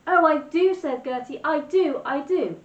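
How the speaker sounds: put-on high, loud, eager little-girl voice. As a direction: plead with bright excitement. Gertie eagerly pleads.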